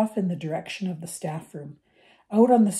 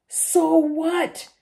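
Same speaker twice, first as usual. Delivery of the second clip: much higher and louder defiant shout; 11 semitones higher, 7 dB louder than usual. A narrator's reading. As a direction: shout back at them. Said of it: "Harry shouted".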